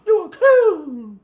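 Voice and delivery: high, loud, slow, drawn-out yelled insult, "coon" stretched and falling; mock angry insult. Fans insulting Wayne for his view, high slow angry shout. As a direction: hurl "coon" like an outraged fan, slowly. People upset at Wayne, mocked.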